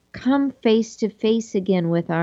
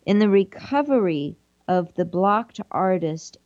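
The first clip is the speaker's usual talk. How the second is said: ordinary talk, nothing marked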